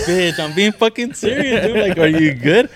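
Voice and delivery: loud, high-pitched insistence; mock-serious yelled protest; indignant shout. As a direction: retell your exasperated outburst at full volume. Retold argument with laughing sister.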